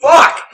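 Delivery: loud, high curse right after sneezing; post-sneeze curse. Real, not acted. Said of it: "That was a loud-ass sneeze".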